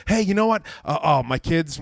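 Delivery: ordinary talk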